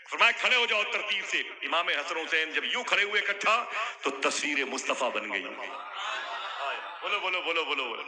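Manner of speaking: high, loud fervent proclamation; full-force preaching. Acted. Preacher whips up the gathering at the climax, fervent full-force preaching.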